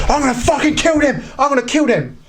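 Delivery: high-pitched mock panic; retold frantic threat without shouting; panicked threat. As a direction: act out a man losing it, pitch leaping up. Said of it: "and he panicked".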